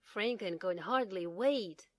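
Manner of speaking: ordinary delivery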